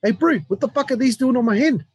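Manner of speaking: imitated wrestler. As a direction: jump up high to imitate his baffled protest. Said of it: "he's like".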